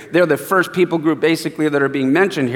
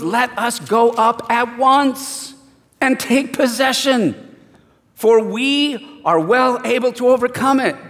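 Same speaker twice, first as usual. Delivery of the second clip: higher and slow, steady confident declaration; bold Caleb; 5 semitones higher, slower than usual. Retold or imitated. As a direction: call them forward with calm courage. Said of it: "He goes".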